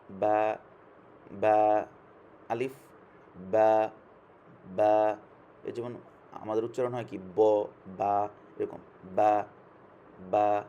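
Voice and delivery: বা said loud with both lips pressed; teacher modelling a strong বা; ba demo. Shown as a demonstration. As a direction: pop বা off closed lips. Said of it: "দুই ঠোঁট একত্র করে বা".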